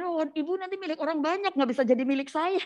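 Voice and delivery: high-pitched mock complaint; retold protest. Husband fearing wife belongs to everyone, mock protest. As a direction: imitate a partner protesting, pitched well up.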